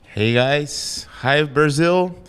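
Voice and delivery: ordinary delivery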